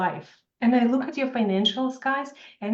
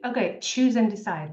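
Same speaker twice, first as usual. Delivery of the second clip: ordinary talk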